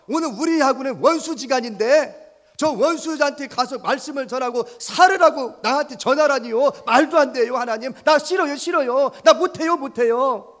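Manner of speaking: high, loud pleading complaint; whiny protest. Acted. Jonah refuses God's command, loud whiny pleading. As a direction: Jonah begging to get out of it.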